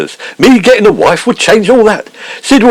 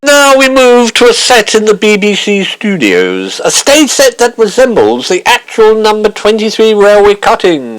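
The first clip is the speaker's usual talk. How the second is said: scene announcer. Acted.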